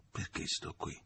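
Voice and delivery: a whispered inner question; whispered thought. A narrator's reading. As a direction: whisper it to himself. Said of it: "pensò".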